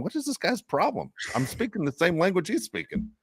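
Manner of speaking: voicing the Australian kid's confusion; imagined baffled kid. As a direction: play the kid wondering what's wrong with him. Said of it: "he had to be thinking".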